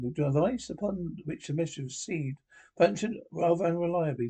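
ordinary delivery

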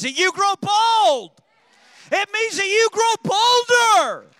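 from a loud call to a soaring, very loud high cry on bolder; building shout. Real, not acted. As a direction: build to a peak, pushing bolder to the top. Preacher rising to climax.

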